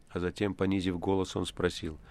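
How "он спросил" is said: ordinary delivery, nothing marked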